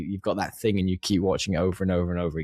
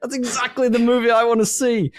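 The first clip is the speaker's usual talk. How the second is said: gleeful; 13 semitones higher, 3 dB louder than usual. Retold or imitated. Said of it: "I'm like".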